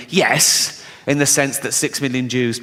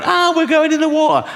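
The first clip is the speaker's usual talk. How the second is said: high, panicky wail; hammed-up shriek of alarm; mock panic; 12 semitones higher, 3 dB louder than usual. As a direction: squeal like a panicking passenger. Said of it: "people running around going".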